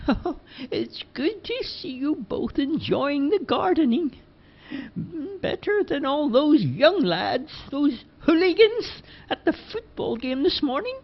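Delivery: put-on high, slow old lady voice; Missus Dockery. A narrator's reading. Missus Dockery chatting to the lads, high slow old lady voice. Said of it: "opened up the window and said".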